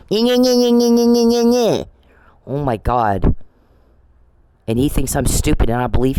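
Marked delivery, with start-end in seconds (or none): 0.1-1.9 high-pitched sing-out whine; needling, whiny imitation, pitched way up; mocking whiny voice. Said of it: "whiny little bitch voice".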